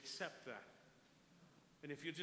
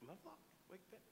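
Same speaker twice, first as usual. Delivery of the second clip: incredulous; 6 semitones higher, 10 dB quieter, faster than usual. Retold or imitated. Inner disbelief at the calling.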